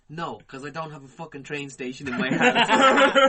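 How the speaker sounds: loud, drawn-out comeback, laughter breaking in; loud defiant retort. Retold or imitated. Drunk man answers the Italian who scolded him, loud and defiant. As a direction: throw it back loudly at your accuser.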